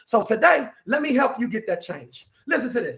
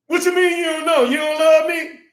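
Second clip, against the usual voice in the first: high, loud disbelief; hammed-up girlfriend, higher and louder; indignant girlfriend; 5 semitones higher, 3 dB louder than usual. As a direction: demand it, hurt and outraged. Woman indignant.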